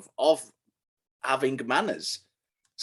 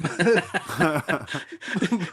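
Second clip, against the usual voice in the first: laughing; 7 semitones higher, 5 dB louder than usual. Real, not acted. Teasing friend, laughing at accent.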